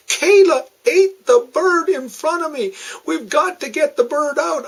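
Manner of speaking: put-on high, tearful little girl; a daughter sobbing out the news; crying child. Upset daughter reports bird eaten.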